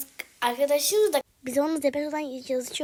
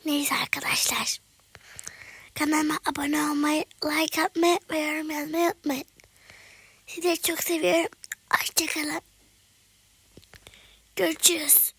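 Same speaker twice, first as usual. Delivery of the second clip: whispery hoarse late-night voice; hushed hoarse voice; 3 semitones higher, 4 dB louder than usual. Real, not acted. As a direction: keep it a raspy whisper so no one wakes. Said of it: "Bu yüzden kısık sesle konuşuyorum".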